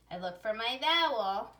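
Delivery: ordinary delivery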